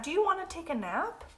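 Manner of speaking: high-voiced cat character; storybook cat. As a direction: ask as a cat, pitched well up. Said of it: "cried the cat".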